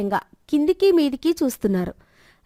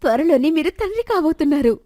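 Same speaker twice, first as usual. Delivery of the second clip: much higher, coy and bashful; wife shyly announcing she is pregnant; 7 semitones higher than usual. A narrator's reading. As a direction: blush as you tell him the good news. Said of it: "సిగ్గుపడుతూ".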